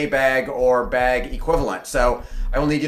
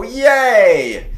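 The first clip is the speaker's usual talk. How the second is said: loud delighted cheer, higher; cheering yay; 7 semitones higher, 5 dB louder than usual. Shown as a demonstration. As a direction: cheer like the crowd loves it. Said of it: "make everybody here go".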